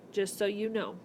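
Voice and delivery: ordinary talk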